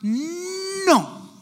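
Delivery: very high, drawn-out mock toddler no; two-year-old refusal. Believer refusing God like a toddler, defiant refusal. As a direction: stretch out a childish, high refusal. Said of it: "you do that to God".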